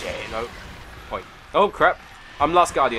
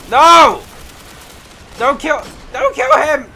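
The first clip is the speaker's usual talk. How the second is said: much higher, much louder frantic yell; panicked shout; 13 semitones higher, 13 dB louder, slower than usual. Real, not acted. Gamer yelling at teammate not to steal the kill. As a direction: scream at them to stop.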